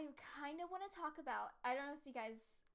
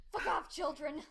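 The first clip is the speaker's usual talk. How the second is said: mock yell; 4 semitones higher, 10 dB louder, slower than usual.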